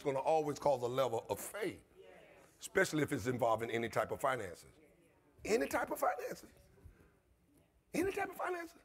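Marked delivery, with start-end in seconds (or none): 5.4-8.8 soaring soft emphatic echo; rising repetition. Acted. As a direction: pitch climbs high and soft on each repeat. Repeating phrase climbing higher.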